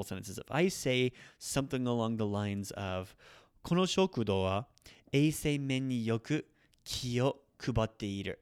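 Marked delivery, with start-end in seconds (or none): none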